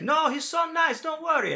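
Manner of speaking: put-on high, reassuring Italian accent; put-on high Italian voice, friendly. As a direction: mimic an Italian guy putting someone at ease. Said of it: "he's got this Italian accent".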